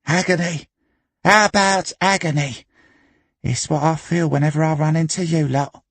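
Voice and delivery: high, put-on charwoman voice; sour pub charwoman. A narrator's reading. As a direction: jeer at the regulars in a high voice. Char jibes at the pub regulars.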